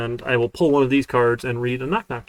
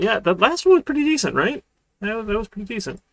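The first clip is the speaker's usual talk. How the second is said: pleased with himself; 8 semitones higher than usual. Real, not acted. Pleased with own joke, pitch jumps.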